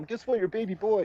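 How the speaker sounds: high-pitched excited call home. Retold or imitated. Excitedly calling his mom.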